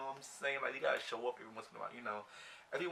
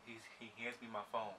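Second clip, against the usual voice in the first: ordinary delivery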